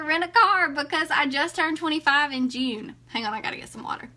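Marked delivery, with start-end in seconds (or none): 3.1-4.1 whisper. Real, not acted. Aside to viewers.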